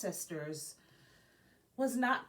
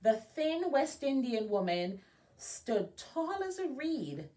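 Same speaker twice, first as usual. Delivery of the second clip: lively storybook narration, a bit louder; reading to children; 4 semitones higher, 5 dB louder than usual. A narrator's reading. Librarian reading a biography aloud to Girl Scouts, lively storybook voice. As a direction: read it like a picture book to kids. Reads picture book aloud.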